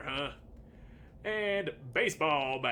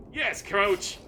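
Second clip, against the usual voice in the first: eager reply; 6 semitones higher, 7 dB louder than usual. Acted. Lawnmower answers again.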